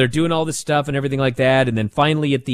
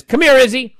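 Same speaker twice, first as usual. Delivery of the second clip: high, loud call to Izzy; mock referee calling Izzy over; ref calling; 9 semitones higher, 6 dB louder than usual. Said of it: "she says".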